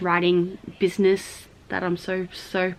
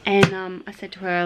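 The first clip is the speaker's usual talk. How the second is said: normal talking voice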